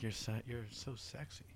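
lightly mocked admirer, hushed and slow; imitowane dziewczyny. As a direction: softly imitate swooning girls, drawing it out. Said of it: "girls being like".

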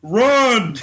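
retelling the dad's panicked yell to flee the falling tree; retold yell. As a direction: bark it out in a laughing panic. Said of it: "my dad's just like".

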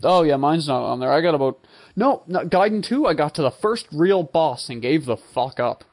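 usual speaking voice

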